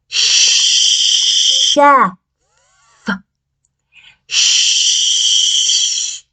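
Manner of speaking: "Chef" stretched with a long unvoiced "sh" and "f"; airy sh in Chef. Shown as a demonstration. Teacher shows the air in "Chef". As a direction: hiss out "Chef" slowly. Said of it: "It goes like this".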